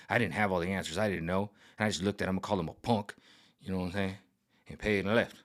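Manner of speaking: low, muttered dad complaining; dad muttering to his son; grumbling dad. Retold or imitated. Dad retelling the store encounter.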